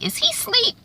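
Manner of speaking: put-on high, small child's voice; child's question. Retelling North's son on the horse, high childlike. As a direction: ask it like an innocent little boy.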